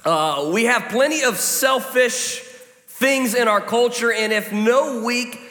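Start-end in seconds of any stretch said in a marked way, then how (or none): none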